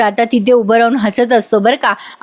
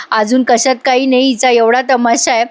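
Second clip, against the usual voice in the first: ordinary delivery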